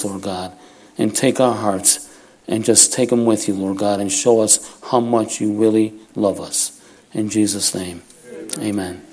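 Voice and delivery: measured, reverent prayer voice with pauses; earnest prayer. Real, not acted. Pastor praying for the congregation before his word, reverent prayer.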